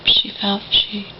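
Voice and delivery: ordinary talk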